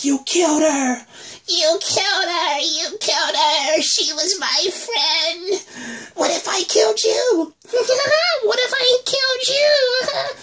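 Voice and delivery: put-on very high, slow, unhinged child scream; insane girl. Lizzie screaming at Carol over the dead walker, high and insane. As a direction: rage like she's lost her mind. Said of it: "insane type voice".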